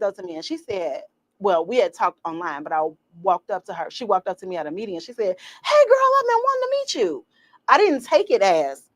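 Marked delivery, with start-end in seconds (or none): 5.6-7.2 very high Southern-style gushing hello; imitated Southern colleague. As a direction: greet her like a Southerner thrilled to meet. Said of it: "I have a colleague from the South".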